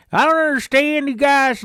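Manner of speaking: high, loud hollered complaint; loud Idaho camper. Retold or imitated. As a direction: holler it so the whole campground hears. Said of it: "saying it really loud so everybody else could hear".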